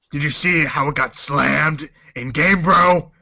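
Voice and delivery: lightly gruff hero voice, a bit slow; mock Batman voice. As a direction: play it as a brooding Batman. Voicing Batman in a dramatic reading.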